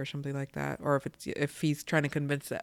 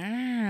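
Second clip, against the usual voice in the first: put-on short angry kid growl; 8 semitones higher than usual. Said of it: "like he's mad or angry".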